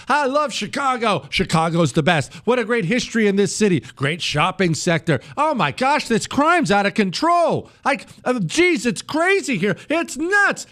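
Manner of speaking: hammed-up city fan turned fretful; mock Chicago complainer. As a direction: sing Chicago's praises, then panic about crime.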